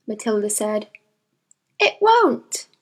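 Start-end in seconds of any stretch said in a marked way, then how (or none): 1.8-2.7 put-on higher child voice; young girl voice. A narrator's reading. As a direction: agree like a serious little girl. Matilda voiced.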